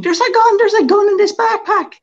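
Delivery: loud, very high-pitched mock scream; screaming in alarm. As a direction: imitate a panicked screener screaming. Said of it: "starts screaming".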